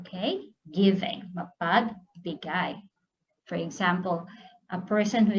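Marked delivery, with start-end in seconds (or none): 1.3-2.8 Mapagbigay said slowly, each syllable clear; careful model word; slow teaching read. Shown as a demonstration. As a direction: spell out Mapagbigay slowly for a learner. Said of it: "Mapagbigay is giving".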